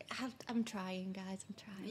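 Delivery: whispered, a touch slow; whispered sheepish aside. Real, not acted. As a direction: breathe it to the listeners. Embarrassed aside about her accent.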